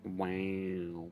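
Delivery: ordinary talk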